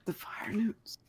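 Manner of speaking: faint, breathless whisper; weak whisper. A narrator's reading. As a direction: barely get the words out. Exhausted dwarf speaks.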